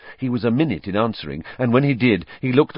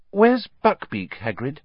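hesitant; 6 semitones higher than usual. Acted. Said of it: "said Hermione hesitantly".

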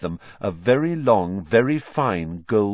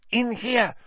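urgent; 13 semitones higher than usual. A narrator's reading. Hermione urgently pulling Harry.

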